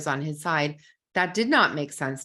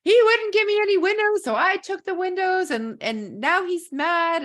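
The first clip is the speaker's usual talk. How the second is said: put-on much higher, louder childish wail, slowing at the end; imitating a crying child; 5 dB louder than usual. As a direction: whine it out like a sobbing kindergartner. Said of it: "came back to me crying".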